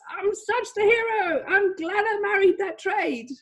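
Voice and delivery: high-pitched, gushing self-congratulation; mock triumph. Teacher imitating trader glad they married the trade, mock-elated. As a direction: crow it high like a delighted hero. Acts a trader gloating over profit.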